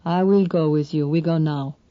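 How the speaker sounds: put-on flat, accented, blunt man; flat Greek accent. A narrator's reading. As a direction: say it flat, no rise. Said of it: "saying flatly".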